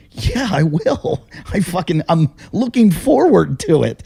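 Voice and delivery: high, drawn-out taunting threat; slow, emphatic comeback; retold defiance. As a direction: act out relishing the threat. Retells threat in the quarrel.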